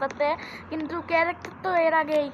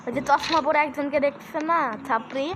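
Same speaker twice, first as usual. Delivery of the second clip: ordinary delivery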